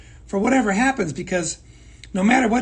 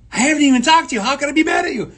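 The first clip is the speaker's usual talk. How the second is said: exasperated retort; 6 semitones higher, 5 dB louder, faster than usual. Retold or imitated. Retold baffled reply to employees.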